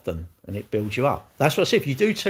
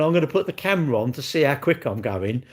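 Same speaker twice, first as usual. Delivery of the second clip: ordinary talk, nothing marked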